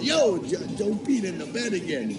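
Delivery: retold kid's complaint, pitched high; calling out. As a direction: call out in mock protest. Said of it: "And then call my mother".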